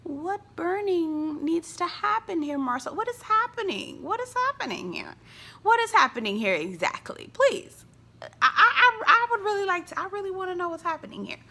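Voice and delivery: reacting to Marceau, incredulous; mock incredulous question. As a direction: ask in comic disbelief. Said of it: "I'm like".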